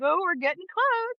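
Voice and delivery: a hint of a thrilled nurse; excited nurse. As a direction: imitate the nurse's chirpy excitement. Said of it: "she was way more excited than I was".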